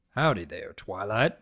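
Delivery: gentle big stallion saying hello; calm Big Mac drawl. Acted. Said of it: "Big Mac voiced".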